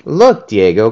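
loud call across. A narrator's reading. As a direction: call out to Diego. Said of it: "called Alicia".

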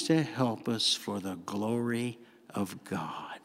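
slow, low and soft, sincere; hushed reverence. Real, not acted. Preacher tells congregation why the Spirit returns, hushed reverence. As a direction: let it sink down nearly to a whisper. Solemn turn to God's love.

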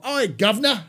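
loud, high over-the-top Cockney shout. Shown as a demonstration. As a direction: ham up a Cockney "Oi, governor!". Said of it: "you don't have to go".

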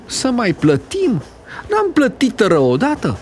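can't believe they must pay again; lady protesting to the conductor; outraged lady. Acted. Ladies outraged at paying.

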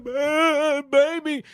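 put-on very high strained baby; high choking baby; choking girlfriend. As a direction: gasp it out high.

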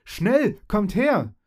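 high, loud call to come; urgent call. Acted. Fairy calls her friends into the room, urgent shout. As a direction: shout them over quickly.

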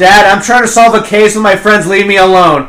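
loud, high-pitched imitation of an exasperated daughter; acted teenage outburst. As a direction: yell at dad like a fed-up teen. Voices teenager snapping at her dad.

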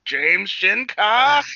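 high, loud, very slow anime cry; hammed-up drawn-out battle shout; mock evolution call. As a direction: proclaim your own transformation. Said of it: "forty years later on Instagram".

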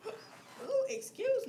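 sudden hiccup gasp. Real, not acted. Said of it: "I got the hiccups".

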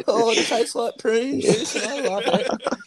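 high, slow hammed-up delight; mock ecstatic drunk. Friend hams up drunk delight in the drink. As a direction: ham up a drunk loving prunes.

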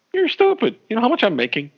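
bad Robert Downey Junior impression. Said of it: "That might be the worst Robert Downey Junior".

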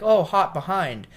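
kitchen call-out. Retold or imitated.

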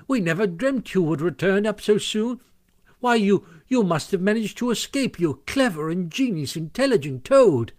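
delighted admiration. A narrator's reading. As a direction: heap excited praise on a friend. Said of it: "he began to dance around him".

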